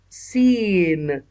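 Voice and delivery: सीन stretched very slowly, the long ee held; drawn-out seen. Shown as a demonstration. Teacher showing a pupil how to say सीन, drawn out slowly. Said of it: "यहाँ पर सीन का मखरज निकल रहा है".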